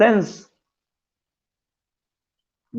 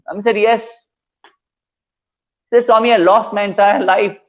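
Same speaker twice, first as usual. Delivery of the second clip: higher, anxious pleading to the master; a devotee's shaken, pleading plea; 6 semitones higher than usual. Retold or imitated. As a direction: beg the guru in distress. Devotee pleads after shock.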